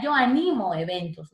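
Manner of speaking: ANIMO as a-NI-mo within the phrase; middle-syllable stress. Shown as a demonstration. Teacher giving a grave example, stress on NI. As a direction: put the force on NI in ANIMO. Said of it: "es una grave".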